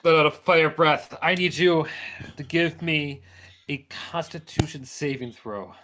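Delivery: ordinary talk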